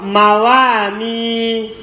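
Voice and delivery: "mawami" drawn out very slowly; slow, syllable-by-syllable model. Shown as a demonstration. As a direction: say "mawami" slowly so they can copy it. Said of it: "Jamaknya mawa". ("mawami" diucapkan sangat pelan)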